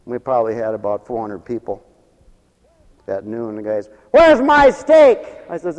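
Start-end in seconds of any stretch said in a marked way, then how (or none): 4.1-5.2 high, loud, slow demand; hammed-up grumpy diner; mock impatient diner. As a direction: drag out the demand like a hungry customer. Impatient diner at noon rush.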